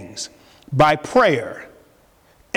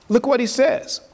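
ordinary delivery

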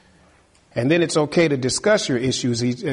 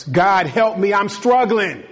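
desperate prayer; 6 semitones higher, 3 dB louder than usual. Acted.

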